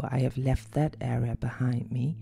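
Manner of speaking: ordinary talk, nothing marked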